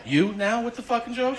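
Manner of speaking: high, laughing comeback; laughing retort. Retold or imitated. Patient firing back at the joking doctor, high and laughing. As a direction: throw the joke back at him, laughing.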